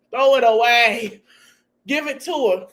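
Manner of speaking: normal talking voice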